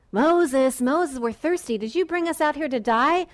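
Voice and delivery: whining thirsty crowd. Acted. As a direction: whine at Moses that you're dying of thirst. Thirsty Israelites complain to Moses.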